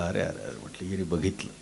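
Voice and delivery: low, hushed alarmed murmur; whisper-close, thinking aloud in a panic; worried muttering to self. Acted. Potter fearing someone saw his gold, a hushed alarmed murmur.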